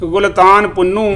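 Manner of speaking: measured, even recital of verse; poem recitation. Acted. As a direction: recite each line like a mushaira couplet. Recites Punjabi poem to audience.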